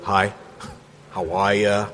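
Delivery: slow, offhand "Hi, how are ya" with a chuckle; casual mock greeting. Shown as a demonstration. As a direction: toss off a lazy everyday hello. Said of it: "We don't do that".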